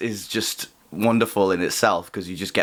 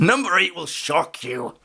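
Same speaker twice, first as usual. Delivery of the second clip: clickbait video narrator; 7 semitones higher than usual. Pretend. Mock clickbait headline.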